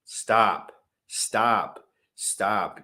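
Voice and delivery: loud, slow, word-by-word warning; emphatic warning. Real, not acted. Warning listeners off buying on Uniswap, slow emphatic command. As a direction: hammer each word like a public warning.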